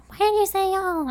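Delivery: high-pitched mocking question; high, teasing imitation; mock questioners. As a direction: imitate baffled people, voice way up. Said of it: "and they're like".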